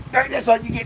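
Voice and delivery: teasing in a high put-on voice; playful put-on voice. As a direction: squeak it out like a teasing character. Acted playful voice.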